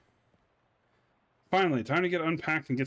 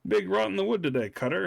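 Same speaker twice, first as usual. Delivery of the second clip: higher, quick put-on greeting; odd character voice; 6 semitones higher, faster than usual. Character greeting the cutter in a higher put-on voice. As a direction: toss off the line in a put-on higher voice. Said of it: "You don't talk like that".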